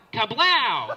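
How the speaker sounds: loud, booming gun noise; big drawn-out cartoon blast; mock gunshot yell. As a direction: shout the sound effect like a gunshot. Robber imitating a gunshot.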